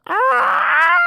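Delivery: high diva wail. Pretend. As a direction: belt a shrill diva cry. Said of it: "that's my diva voice".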